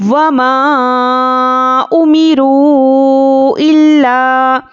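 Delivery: slow, melodic tajweed recitation; measured Quranic chant. Shown as a demonstration. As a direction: chant it slowly like a qari. Recites verse fragment.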